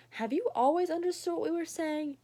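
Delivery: curious family voice. Acted. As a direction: ask the dog in wonder. Family member asks dog.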